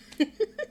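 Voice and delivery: short high burst of laughter; a quick squeaky laugh. Real, not acted. Laughs at joke.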